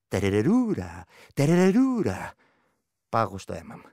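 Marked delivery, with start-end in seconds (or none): 0.1-2.3 strange drawn-out ghostly trill; eerie creature call. Acted. The vrykolakas calls from the dark, eerie trill. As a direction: sing it like a lurking spirit.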